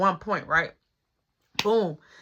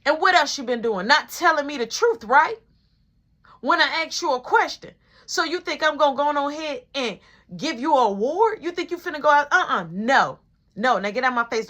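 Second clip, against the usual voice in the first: louder, higher scolding mom; angry mom; 5 semitones higher, 4 dB louder than usual. Retold or imitated. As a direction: lay into him like mom.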